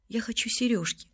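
softly squeezed-out wish, understated; quiet, lightly tearful confession; sobbing child. A narrator's reading. Maria tells the family what she wants, lightly tearful. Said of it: "всхлипывая, выдавила из себя Мария".

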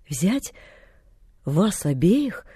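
slow, baffled repetition; utterly bewildered. A narrator's reading. Man puzzled by the girl's proposal, bewildered. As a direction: echo it haltingly, thrown completely. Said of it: "повторил совершенно сбитый с толку".

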